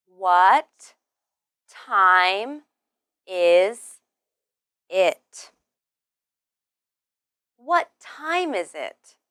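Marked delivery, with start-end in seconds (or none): 0.1-5.5 slow, each word separated by a pause; louder, isolated words for learners. Shown as a demonstration. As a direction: say it one word at a time. Word-by-word pronunciation demo for learners.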